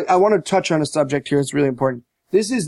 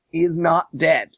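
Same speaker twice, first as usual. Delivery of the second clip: ordinary delivery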